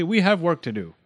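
brisk scolding tone; sharp. A narrator's reading. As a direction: rebuke the patient and get on with it. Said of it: "said the dentist sharply".

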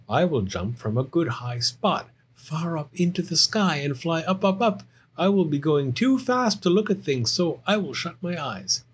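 high bear cub, climbing in pitch; excited Little Bear. Acted. Little Bear planning his flight, high and excited. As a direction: play a young bear planning a great leap.

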